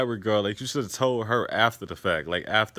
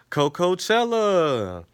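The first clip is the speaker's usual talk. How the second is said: drawn-out Coachella; 7 semitones higher, 4 dB louder, much slower than usual. Real, not acted. Gleefully naming the dreaded plan.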